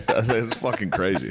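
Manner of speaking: ordinary delivery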